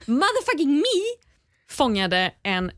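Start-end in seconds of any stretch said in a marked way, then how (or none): 0.1-1.3 high, slow swaggering brag; mock boast. Real, not acted. Boasting about catching the giant crab, high and swaggering. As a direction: hype yourself up.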